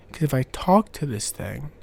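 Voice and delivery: usual speaking voice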